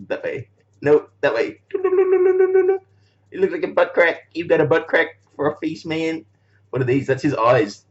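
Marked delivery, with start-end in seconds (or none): 1.7-5.8 much higher, slow squeaky taunt; silly toy voice. Pretend. Vlogger teasing his toy figure, slow high squeak. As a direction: drag it out in a high cartoon squeak.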